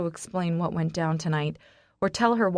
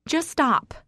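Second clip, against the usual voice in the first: high pleading cry; 9 semitones higher, 3 dB louder than usual. Acted. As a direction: cut him off with a high cry. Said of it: "I cry".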